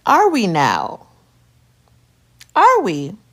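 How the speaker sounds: slow, loud and doubting; sarcastic. Retold or imitated. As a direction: drag it out with disbelief. Sarcastic reply to husband.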